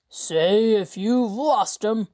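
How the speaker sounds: slow, menacing rough voice; unfinished threat. Acted. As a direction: leader warning his man, cut off. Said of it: "did not finish the threat".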